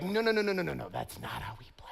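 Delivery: God gently whispering no, that's not how we play; hushed no. Pretend. Pastor voicing God to the congregation, whispered refusal. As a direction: drop to an unvoiced whisper.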